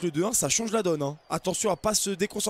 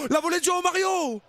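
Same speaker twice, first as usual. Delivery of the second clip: higher, shouted chance on goal; commentator's sudden shout; excited call; 11 semitones higher than usual. Acted. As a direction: yell the volley as it happens.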